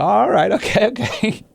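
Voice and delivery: very high mock acceptance, near laughter; squeaky-high sarcastic okay. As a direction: pretend to agree, tickled. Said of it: "sarcastic line of questioning coming his way".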